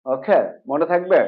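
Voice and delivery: ordinary talk, nothing marked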